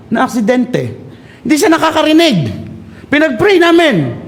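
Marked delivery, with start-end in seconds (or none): none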